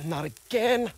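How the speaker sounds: loud, high exasperated outburst; frustrated it's happening again, burst out; exasperated groan. Real, not acted. Police scanner interrupts again.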